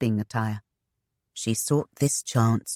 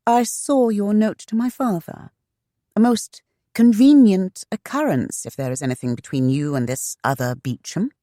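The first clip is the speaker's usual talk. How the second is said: raised, accusing woman's voice; suspicious young woman; 7 semitones higher, 4 dB louder than usual. Acted. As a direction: needle him with suspicion.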